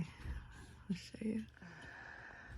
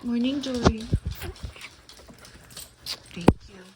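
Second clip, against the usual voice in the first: talking to a dog; 6 semitones higher than usual. Real, not acted. Greeting dog.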